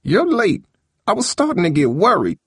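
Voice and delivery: high, fretful grandmother voice; high-pitched cross, worried old woman; scolding Grandma. Acted.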